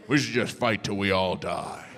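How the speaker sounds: a low, gruff voice for a doomed vow. Retold or imitated. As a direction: give the order to fight to the death in a low, gruff voice. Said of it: "just decided".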